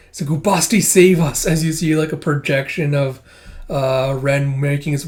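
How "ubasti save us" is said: louder, higher cry for help; desperate cry. Retold or imitated. Frightened figure crying to the goddess, loud desperate plea.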